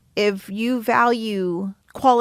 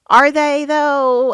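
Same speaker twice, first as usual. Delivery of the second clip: loud, higher, drawn-out doubting question; sceptical challenge; 6 semitones higher, 8 dB louder, much slower than usual. Acted. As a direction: question it loudly, stretching the words. Challenges friends' complaints.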